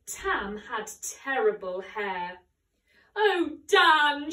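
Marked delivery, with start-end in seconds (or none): 3.1-4.3 loud, high wail; wailing girlfriend, much louder. A narrator's reading. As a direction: cry out in despair. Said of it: "she wailed".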